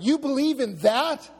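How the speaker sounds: high, loud incredulous jeering; acted scornful questions, pitched well up; mocking disbelief. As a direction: imitate a scoffer ridiculing a belief. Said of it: "You're gonna get mocked".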